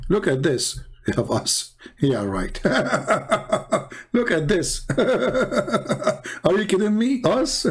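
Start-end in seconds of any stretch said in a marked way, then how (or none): 1.1-2.6 low, dry sarcasm; sarcastic. Real, not acted. Mocking a rival teacher's claim, dry and sarcastic. 2.6-7.7 high laugh, slow drawn-out words; laughing disbelief. Real, not acted. Reacting to the rival video, laughing in disbelief.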